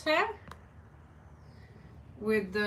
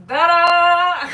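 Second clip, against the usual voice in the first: much louder and higher, bursting into a laugh; 7 semitones higher, 12 dB louder than usual. Real, not acted. Vlogger revealing the new camera angle, loud and laughing. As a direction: show it off with a delighted laugh.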